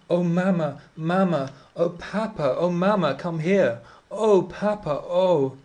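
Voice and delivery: high, loud panicked wail for mamma and papa; a spoiled child shrieking for her parents; howling cry. A narrator's reading. Girl howling for her parents in fright, high loud wail. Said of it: "They really did howl out".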